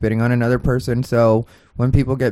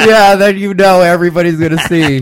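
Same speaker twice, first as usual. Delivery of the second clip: laughing; 7 semitones higher, 9 dB louder than usual. Real, not acted.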